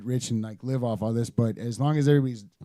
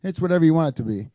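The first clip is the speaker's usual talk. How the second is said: quick, louder, cocky brush-off; smart-ass brush-off; 4 semitones higher, 7 dB louder, faster than usual. Retold or imitated. Fans asking what Sherby means, answered with a smart-ass brush-off. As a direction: toss it off like a wise guy. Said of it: "be smart-assy about it".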